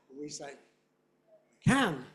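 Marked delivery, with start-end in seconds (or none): 1.6-2.0 loud "Can" with the pronoun almost vanished; pronoun nearly gone. Shown as a demonstration. Teacher comparing English with Spanish, pronoun almost dropped. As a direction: punch "Can", swallow the pronoun. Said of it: "the pronoun is almost not there".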